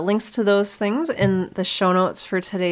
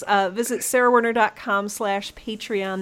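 ordinary talk, nothing marked

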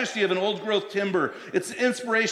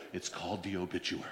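deadpan pastor; 11 semitones lower, 14 dB quieter, faster than usual. Retold or imitated. Deadpan punchline from stern pastor.

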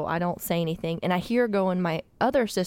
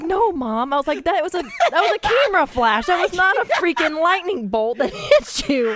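higher and louder, incredulous laughing protest; exasperated disbelief; 9 semitones higher, 5 dB louder than usual. Retold or imitated. Telling mom it was a camera flash, exasperated and loud. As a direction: insist it was only a flash.

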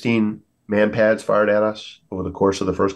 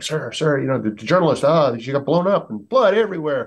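freaking out; 6 semitones higher than usual. Retold or imitated. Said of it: "he's freaking out".

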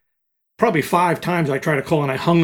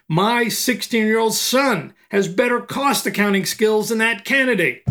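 mock yell, slow; 6 semitones higher, slower than usual. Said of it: "and really yelling".

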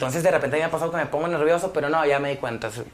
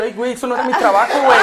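higher, laughing mock panic; mock panicked self; 6 semitones higher, 6 dB louder, faster than usual. Rapper imitating his own nerves on stage, mock panic. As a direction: act out your nerves as a joke, half laughing. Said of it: "Tú es como que".

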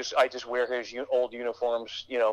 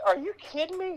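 much higher, scoffing; as if the idea is a joke; disbelief. Retold or imitated. Dad mocking son's army hopes, high disbelieving. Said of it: "And he said".

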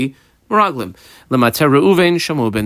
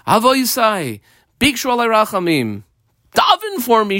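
Kalev pleading; 9 semitones higher than usual. Retold or imitated. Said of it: "And Kalev said to them".

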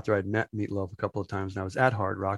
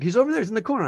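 eager server; 9 semitones higher, much faster than usual. Retold or imitated. Server pointing out the star.

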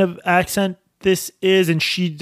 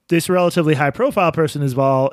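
ordinary talk, nothing marked

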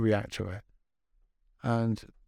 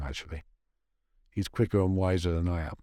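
usual speaking voice

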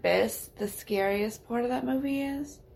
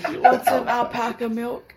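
laughing quote; 2 semitones higher, 7 dB louder than usual. Retold or imitated. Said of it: "quoting Cage in the movie".

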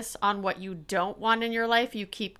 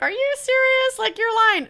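high-pitched, louder disbelief; incredulous; 13 semitones higher, 5 dB louder than usual. Acted. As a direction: challenge them as if it can't be true. Acts out others' disbelief.

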